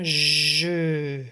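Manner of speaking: long, loud JE; "je" pushed out and held; syllable stretched. Shown as a demonstration. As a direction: sustain "je" with a buzzing start. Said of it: "on entend le son je".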